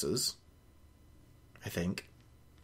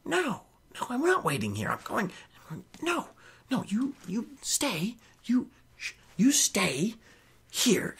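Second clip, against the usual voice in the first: softer, half-whispered refusal that stumbles over itself; hushed stubborn protest; 8 semitones higher, 6 dB quieter than usual. Retold or imitated. Kalan refuses to wait, whispering back at Richard. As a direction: insist in a whisper, tripping on the words. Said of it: "And her going".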